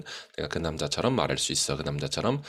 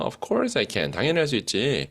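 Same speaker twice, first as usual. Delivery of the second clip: confident giraffe, higher pitched; lightly voiced giraffe boasting; mock giraffe voice; 7 semitones higher, slower than usual. Teacher acting the giraffe's boast, mild cartoon giraffe voice. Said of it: "우리 기린의 목소리를 또 따라하고".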